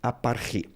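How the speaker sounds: Aparhe with the stress on the final syllable, apar-HE; stress on last syllable. Shown as a demonstration. Teacher models the Greek stress for the class, on the last syllable. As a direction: land on the end of Aparhe. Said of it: "A sílaba tônica é no último".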